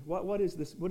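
ordinary talk, nothing marked

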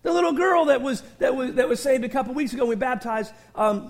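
usual speaking voice

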